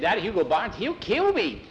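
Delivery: high-pitched alarm, climbing at the end; panicky character voice. A narrator's reading. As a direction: let dread push the voice up. Skeeter dreads the bully.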